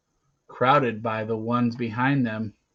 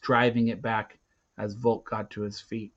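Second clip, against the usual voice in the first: plain reading voice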